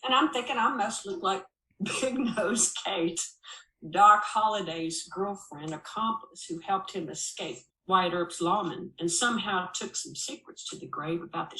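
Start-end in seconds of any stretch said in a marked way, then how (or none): none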